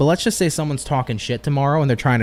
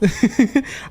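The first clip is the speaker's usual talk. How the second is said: laugh; 8 semitones higher than usual. Real, not acted. Laughing at a surprise photo.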